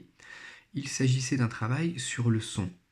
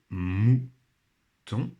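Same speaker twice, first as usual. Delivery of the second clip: "mouton" split into mou, long pause, ton; syllable by syllable; 4 dB louder than usual. Shown as a demonstration. Teacher pointing out a sound in the word, syllable by syllable. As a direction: sound out "mouton" slowly in two beats. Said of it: "Le son ou est ici".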